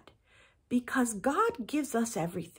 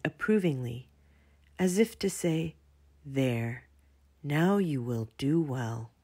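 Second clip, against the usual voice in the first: put-on gentle, lower storybook reading for children; story narration; 4 semitones lower than usual. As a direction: read it to the kids calmly, like a bedtime story.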